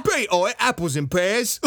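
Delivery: high, loud hammed-up Cockney accent; mock Cockney. Host imitates the Cockney guard at the detention facility, hammed-up Cockney. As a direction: ham up a Cockney geezer, all rhyming slang. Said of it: "Cockney man".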